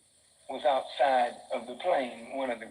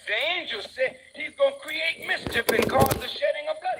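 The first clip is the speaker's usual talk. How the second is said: ordinary delivery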